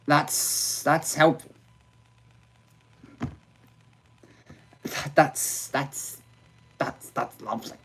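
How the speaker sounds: dry, sarcastic, half-whispered; sarcastic muttering. Real, not acted. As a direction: mutter the praise bitterly.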